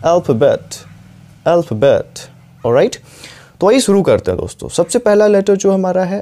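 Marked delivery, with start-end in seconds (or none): 0.0-2.1 अल्फ़ाबेट as ɛl-fa-bet, first syllable stressed; model pronunciation. Shown as a demonstration. Teacher repeats अल्फ़ाबेट for students to copy. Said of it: "इसको आपको pronounce करना है".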